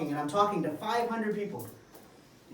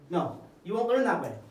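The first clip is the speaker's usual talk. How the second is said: normal talking voice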